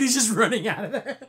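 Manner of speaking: laughing through the words; real amused laughter. Real, not acted. Recapping the funny next scene to friends, laughing. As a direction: break into a laugh while telling it. Amused by the freeze-frame gag.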